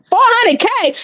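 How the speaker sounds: high-pitched exclamation of disbelief; real surprised outburst; astonished. Real, not acted. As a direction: blurt it out in high-pitched amazement. Exclaims at follower count mid-story.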